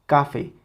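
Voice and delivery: "Café" hit on "Ca", falling away on "fe"; sin fuerza en la última. Shown as a demonstration. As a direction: say "Café" as CAfe. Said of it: "Si le quitamos esa intensidad". ("Café" con el golpe en "Ca")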